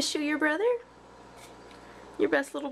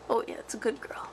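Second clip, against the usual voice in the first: low, whispery praise to a pet; soothing murmur; 7 semitones lower, 4 dB quieter than usual. Real, not acted.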